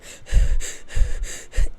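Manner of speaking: loud exaggerated out-of-breath panting; imitated heavy panting. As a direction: mimic a character gasping for breath. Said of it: "she is panting the entire time".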